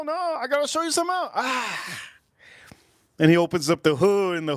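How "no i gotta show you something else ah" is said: high squeaky eager friend. Retold or imitated. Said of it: "friend quoted showing car".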